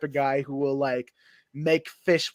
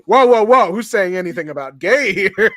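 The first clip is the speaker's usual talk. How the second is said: defensive shout; 4 semitones higher, 9 dB louder than usual. Retold or imitated. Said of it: "that's a Norm Macdonald bit".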